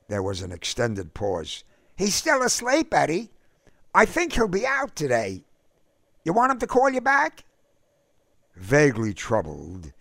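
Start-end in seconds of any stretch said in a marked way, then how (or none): 2.0-7.4 much higher woman's voice, evasive; Myra on the phone. A narrator's reading. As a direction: cover for him sweetly on the phone.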